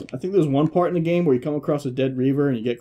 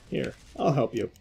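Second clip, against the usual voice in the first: ordinary talk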